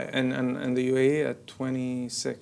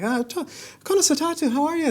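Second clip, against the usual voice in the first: high, louder put-on Irish accent; mock Irish greeting; 3 dB louder than usual. Picturing himself in headgear, in a mock Irish accent. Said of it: "Imagine I was in full headgear".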